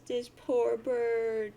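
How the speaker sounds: loud, very slow pitying lament; sad. Acted. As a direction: draw it out with hammed-up pity. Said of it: "sad face".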